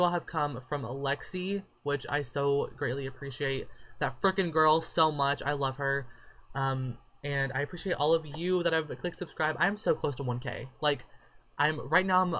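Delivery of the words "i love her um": ordinary talk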